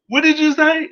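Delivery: very high, loud mock outrage; high hammed-up threat; mock furious repeat. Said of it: "She's like".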